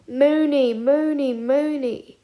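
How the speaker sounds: fading, teasing repetition. A narrator's reading. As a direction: chide him playfully. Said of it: "shook his head infuriatingly".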